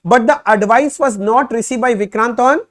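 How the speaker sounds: slow, loud, word-by-word reading of the problem; reading the question aloud. Shown as a demonstration. Teacher reading the problem to students, slow and loud. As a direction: dictate each phrase slowly for the class.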